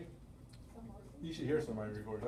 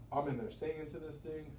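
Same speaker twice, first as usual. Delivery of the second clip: ordinary delivery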